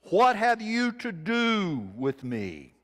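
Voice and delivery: slow demon plea. Acted. Possessed man cries to Jesus.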